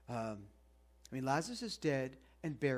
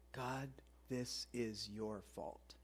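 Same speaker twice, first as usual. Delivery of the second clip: much softer, slow, low accusation; a grieving sister telling God it's his fault, hushed and bitter; bitter blame; 6 semitones lower, 12 dB quieter, much slower than usual. Retold or imitated.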